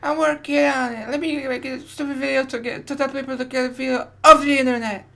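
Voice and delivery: squeaky, high whiny voice; high mock voice. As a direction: parody him in a high whine. Imitating a video maker.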